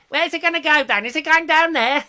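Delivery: very high, loud comic film voice; hammed-up squeal of a music-hall comedian. Host imitating old films, high daft comedian voice. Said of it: "the old [name] films".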